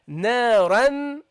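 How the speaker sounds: naran slow, n held toward dhal; ghunnah toward dhal. Shown as a demonstration. Teacher demonstrating ghunnah to students. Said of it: "lean towards the point of articulation".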